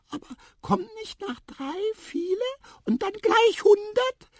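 very high squeak, loud at the end; squeaky mouse. A narrator's reading. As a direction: squeak a puzzled question. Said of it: "piepste Tiputip". (piepsig fragende Maus)

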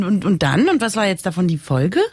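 ordinary delivery

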